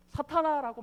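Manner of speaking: loud, high cry of Satan; harsh rebuke. Acted. Jesus turning on Peter, loud harsh rebuke. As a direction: fling the word at him.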